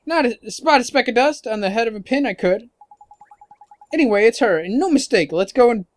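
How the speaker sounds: lightly voiced cocky fairy, emphatic; boastful fairy sidekick. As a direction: brag like a feisty little sidekick.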